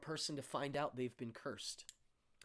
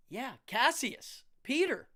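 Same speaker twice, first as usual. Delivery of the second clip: normal talking voice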